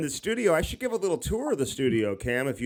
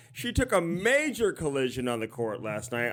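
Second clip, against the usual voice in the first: normal talking voice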